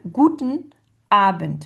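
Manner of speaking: "Guten ... Abend", loud GU- and A-, split by a pause; first-syllable stress. Shown as a demonstration. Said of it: "sur la première syllabe".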